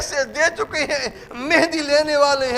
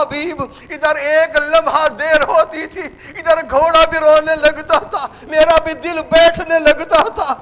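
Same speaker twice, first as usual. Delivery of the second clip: loud, higher sermon lament, slowed on Karbala; preaching at full force; 3 semitones higher, 5 dB louder than usual. A narrator's reading. Servant tells Habib of waiting, preached loud and grieving.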